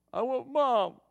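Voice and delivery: put-on high, mildly whiny little boy's voice; a touch of a tearful kid; crying child. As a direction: imitate a sulky toddler asking for mom. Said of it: "just bawling".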